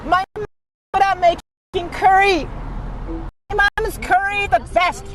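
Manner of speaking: very high, loud squeaky boast; squeaky cartoon voice. High squeaky curry chant.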